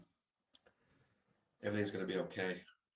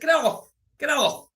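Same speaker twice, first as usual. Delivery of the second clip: high, loud repeated shout; frantic yelling; 15 dB louder, slower than usual. Retold or imitated. Shouting at the stranger rubbing his back: frantic high yell. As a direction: shove him off with your voice.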